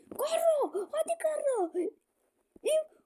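high calls of the name; will him on from the couch; excited cheering. Real, not acted. Gamer urging Garro during a chance, high excited calls.

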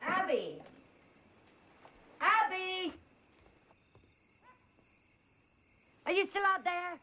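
high, worried call. Acted. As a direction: call out into the yard. Calling out into the dark.

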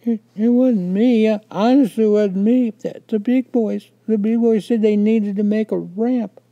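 high, loud tearful wail; wailing little boy in tears, pitched very high; crying. A narrator's reading. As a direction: sob out a child's desperate denial. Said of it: "I started to cry".

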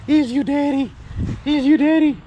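high-pitched, excited talk to a dog; real high, excited pet voice. Real, not acted. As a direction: squeal it playfully to hype up the dog.

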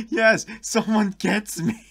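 loud, high, drawn-out cheer; excited agreement. Real, not acted. Delighted reaction to a comment.

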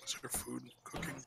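soft unvoiced whisper. Real, not acted. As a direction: whisper the question. Whispered question.

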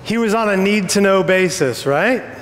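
normal talking voice

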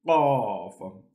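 Qaf popped hard and deep from the throat; Arabic letter Qaf. Shown as a demonstration. Teacher models Qaf for students. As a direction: click Qaf from deep inside. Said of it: "more from the inside. So we say".

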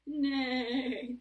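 loud playful whinny; horse neigh. Pretend. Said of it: "Can we be a horse together".

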